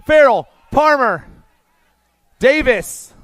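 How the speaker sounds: loud, high race call; finish line shout. Real, not acted. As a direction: roar them across the line. Race finish called at full voice.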